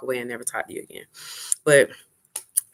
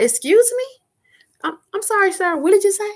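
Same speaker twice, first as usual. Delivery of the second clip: high, squeaky mock outrage, higher and louder; mock shocked; 6 dB louder than usual. Retelling her reply to his flirty line, in mock shock. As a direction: play scandalised, clutching your pearls.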